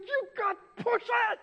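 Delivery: high, loud coaching shout; spotter yelling. Retold or imitated. Gym spotter yelling encouragement at the lifter. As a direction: cheer him on at full voice. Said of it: "he's, like, yelling at me".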